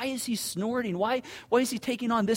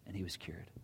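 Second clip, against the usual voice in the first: whisper; 13 semitones lower, 15 dB quieter than usual. Real, not acted. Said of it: "dramatic whispered reveal".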